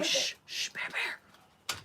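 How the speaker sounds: whispered shushing; soft, voiceless shush to a pet; hushing. Real, not acted. Owner hushes Bear Bear, whispered shushing.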